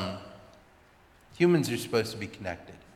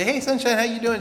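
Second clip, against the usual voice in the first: cheery hallway greeting; 9 semitones higher, 4 dB louder than usual. Retold or imitated. Waving to her in the hall.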